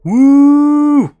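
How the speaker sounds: loud, high cheering woo; excited woo. Real, not acted. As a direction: whoop like a hyped fan. Hyping his favourite monster.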